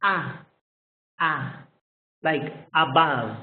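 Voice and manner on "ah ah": ah said soft and short. Shown as a demonstration. Teacher demonstrates the soft schwa sound. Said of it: "This is the soft".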